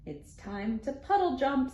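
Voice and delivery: plain reading voice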